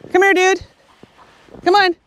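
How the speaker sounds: calling the dog in a high voice; high, friendly call to a dog. Real, not acted.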